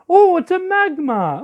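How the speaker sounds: high-pitched cooing surprise; cooing delight. Pretend. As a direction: squeal over a cute creature. Coos at opposing Pokemon.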